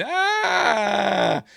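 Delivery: high shouted imitation of Luke's scream; retelling a movie scream, loud and high; mock scream. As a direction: belt out a high falling cry. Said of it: "he wasn't going".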